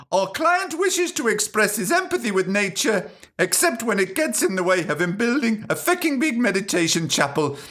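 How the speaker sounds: high-pitched mock solicitor voice; solicitor's letter. Solicitors warn the show to desist, high mock-lawyer voice. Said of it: "Sue Grabbit and Bastard Solicitors".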